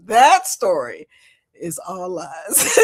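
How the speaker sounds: normal talking voice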